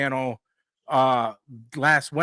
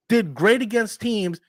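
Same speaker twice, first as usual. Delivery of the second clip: usual speaking voice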